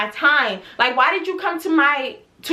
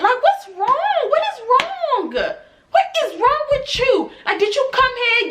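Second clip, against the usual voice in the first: exasperated rant; 11 semitones higher than usual. Real, not acted. Stylist ranting at client.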